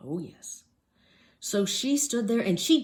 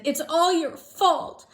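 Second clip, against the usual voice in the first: put-on high, loud childish complaint; girl on the shelf scolding her dad; indignant little girl; 10 semitones higher, 6 dB louder than usual. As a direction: blame dad like a cross kid. Child blames father.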